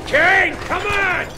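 high, loud urgent yells; calling out. Acted. Lynch yelling to Kane mid-escape, high and loud. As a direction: yell for Kane across the chaos.

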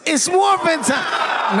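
high, drawn-out rallying cry; catchphrase shout. Shown as a demonstration. Guest giving fans the Power Rangers catchphrase, rousing shout. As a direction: call it out long and high for the fans.

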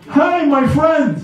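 mock merchant beckoning; street seller's call. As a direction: call out to lure a passerby.

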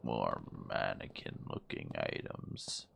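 unvoiced hushed whisper; hushed creeped-out whisper. Real, not acted. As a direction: whisper it so nothing hears.

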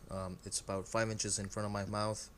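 clip-on mic recording; lavalier mic sound. Real, not acted. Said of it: "Now I'm recording using the small microphone".

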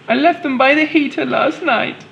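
high, hammed-up storybook crying voice. Helen telling Daniel her eggs vanished, high mock-tearful voice. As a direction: pitch it up high like a mock-tearful dinosaur mum. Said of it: "she cried".

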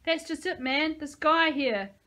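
scared pleading shout. A narrator's reading. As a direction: plead for his life. Threatened man shouting through gate.

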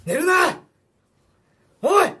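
loud urgent yell; shouting. Acted. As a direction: shout to wake someone up. Acted snow rescue, waking partner.

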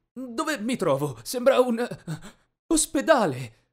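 high, halting confusion; bewildered waking. A narrator's reading. Patient waking up in hospital, startled and confused. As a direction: piece it together, then exclaim.

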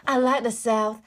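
playful put-on Southern US accent; American Southern accent. Shown as a demonstration. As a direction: try on an American Southern twang. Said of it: "now you do a southern accent".

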